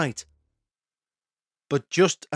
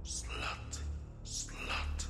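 malicious low gravelly whisper; 18 dB quieter, much slower than usual. Retold or imitated. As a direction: hiss it in the ear like a demon. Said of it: "it whispered in my ear".

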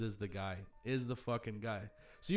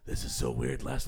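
much lower, rasping gravel Batman growl; 12 semitones lower than usual. Retold or imitated. Batman talking to his stabber, gravel growl. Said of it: "Is it the Batman voice?".

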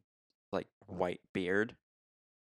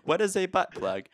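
higher, louder recited line; movie quote; 9 semitones higher, 5 dB louder than usual. Acted. Podcaster reciting from the movie to co-host, hammed-up question. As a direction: deliver it as a quoted film line. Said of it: "Reciting lines from the movie".

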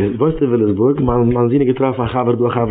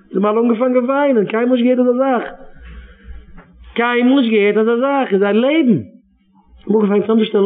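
higher, pressing sermon voice; preacher urging parents to save their children; impassioned preaching; 9 semitones higher than usual. Acted. Preaches again, rising count.

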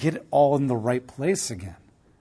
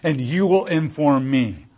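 booming, thundering challenge from God, louder; God's voice; 5 dB louder than usual. Acted. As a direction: proclaim it like God from the storm, loud and commanding. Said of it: "So he says".

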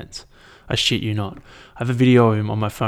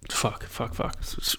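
muttered curses; 9 dB quieter, slower than usual. Real, not acted. Muttered after flubbing line.

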